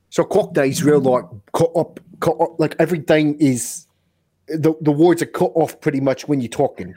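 put-on Cockney with cut-off words; American attempting Cockney, swallowing word endings; Cockney accent. Shown as a demonstration. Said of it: "I don't know if it's Cockney".

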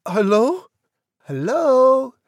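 put-on very high, loud girlish phone greeting; high questioning falsetto. A narrator's reading. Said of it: "she said".

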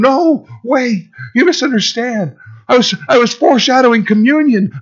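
high, rushed pleading; mock Jesus chasing them, frantic; pleading backpedal. Imagined Jesus chasing the leaving crowd: frantic pleading.

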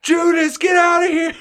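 put-on high, loud shout of a heckling kid; imitated yelling. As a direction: yell it like a student jeering at a bus. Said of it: "one kid was yelling".

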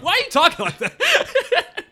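high, loud, laughing question; laughing disbelief. Acted. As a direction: crack up while asking, incredulous and drawn out. Laughing at the mocked accent.

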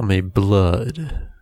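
slow, low menacing announcement; tough-guy name. Pretend. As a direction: drag out the name like a movie villain. Picking a tough, scary name.

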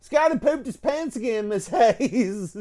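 high, sing-song tattle to the teacher; tattling kid. Retold or imitated. Tim tattling to Miss Hayes about Scotty, mock sing-song. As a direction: play the snitch calling out to teacher. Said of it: "but he was like".

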